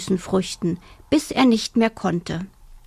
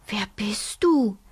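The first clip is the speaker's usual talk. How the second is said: whispered question. Acted. Little bear whispers shyly to the strange hare. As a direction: breathe the question timidly. Shy animals meet at dawn.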